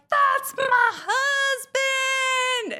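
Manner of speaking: very high, slow shriek; fangirl shout. Retold or imitated. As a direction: wail it like an obsessed teen fan. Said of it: "I was like".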